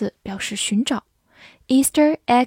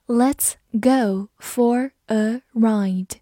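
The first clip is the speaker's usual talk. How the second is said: very slow, word by word; slow model reading; much slower than usual. Shown as a demonstration. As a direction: separate each word of "Let's go for a ride". Slow model reading, then natural. (一字一顿地慢读)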